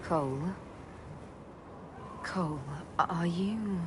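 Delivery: hushed, hesitant question; tentative concern. Acted. Friend checking on changed Cole, hushed and hesitant. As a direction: ask gently, unsure of the answer.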